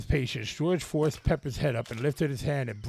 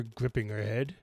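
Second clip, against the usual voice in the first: usual speaking voice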